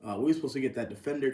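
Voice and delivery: ordinary talk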